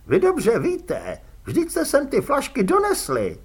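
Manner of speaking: high, loud nervous complaining voice; a cornered little crook whining his grievance; whiny prisoner. A narrator's reading. Fredka protests.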